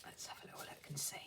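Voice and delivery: fast breathy whisper to herself. Real, not acted. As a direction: whisper it quickly under the breath. Softly peeking at a card.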